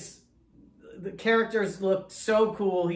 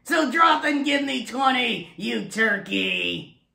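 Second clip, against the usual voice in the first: barking drill sergeant, high and loud; 9 semitones higher, 6 dB louder than usual. Acted. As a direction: shout orders like a drill sergeant. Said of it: "And here he is".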